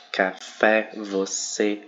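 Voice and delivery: ordinary talk, nothing marked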